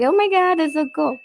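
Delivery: high-pitched proud gush. Retold or imitated. Mother reacting to her daughter's art, gushing high.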